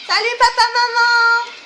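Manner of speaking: put-on high, loud girlish greeting; high cutesy girl voice. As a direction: chirp it like a sweet schoolgirl. Voicing the chainsaw girl.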